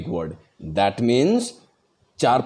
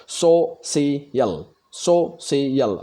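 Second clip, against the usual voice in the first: Social drawn out very slowly, syllable by syllable; social broken into syllables, very slow; syllable split; much slower than usual. Shown as a demonstration. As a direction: stretch social into so-shal, each syllable held. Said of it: "कितनी बार मैं उस शब्द को टुकड़ा कर सकता हूँ".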